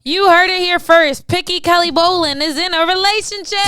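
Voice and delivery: loud, high hype-man announcement; announcing her friend's secret to the audience, loud mock announcer. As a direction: boom it out like breaking news. Announcing friend's news to viewers.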